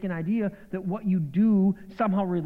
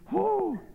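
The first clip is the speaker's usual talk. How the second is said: high whoop. Real, not acted. Whoops over licorice.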